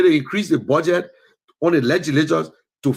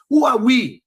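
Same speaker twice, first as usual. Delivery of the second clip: rhetorical outcry; 7 semitones higher, 4 dB louder than usual. Real, not acted.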